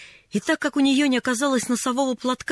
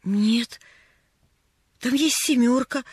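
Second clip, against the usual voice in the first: plain reading voice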